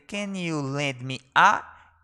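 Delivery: slow pattern sentence with open end; drawn out, leaving the gap; slow model phrase. Shown as a demonstration. Teacher giving class the pattern, slowly. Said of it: "teacher models phrase slowly".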